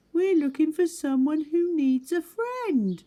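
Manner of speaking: small high eager animal voice; Little Beaver voice. Acted. Little Beaver asking new animals to be friends, high eager voice. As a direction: play a hopeful little beaver, bright and high.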